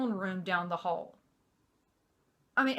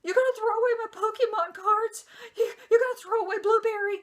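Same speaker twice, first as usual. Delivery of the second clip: put-on high, loud tearful wail of a young girl; crying child; 13 semitones higher, 6 dB louder than usual. Daughter begging her dad not to throw her things away, crying. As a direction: voice her crying in disbelief, much higher and louder. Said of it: "she immediately starts crying".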